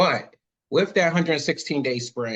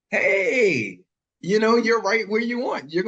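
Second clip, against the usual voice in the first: excited; 8 semitones higher, 4 dB louder, slower than usual. Acted. Voices customer deal pitch.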